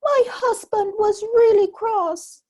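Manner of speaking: high, loud fretful wife voice; a worried giant's wife telling her tale. A narrator's reading. Giant's wife telling Jack, high and loud.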